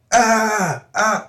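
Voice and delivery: high strained "ah, ah"; mock wordless straining. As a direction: try to talk with no words coming. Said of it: "'cause I couldn't say words yet".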